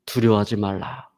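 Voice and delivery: low, softened voice of God; still small voice. Retold or imitated. God answers the man hanging from a branch, low gentle voice. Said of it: "세미한 음성이 들려오기 시작했습니다".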